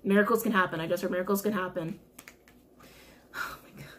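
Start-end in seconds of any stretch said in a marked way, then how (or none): 3.3-3.9 very soft, voiceless gasp of awe; hushed, overcome aside; overwhelmed whisper. Real, not acted. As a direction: whisper it under your breath. Overwhelmed by the repeated message.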